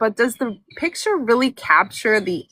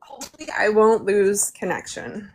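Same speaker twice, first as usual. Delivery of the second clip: ordinary talk, nothing marked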